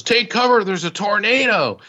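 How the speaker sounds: panicked high call to take cover; urgent warning. Retold or imitated. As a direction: shout the tornado warning. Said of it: "he goes".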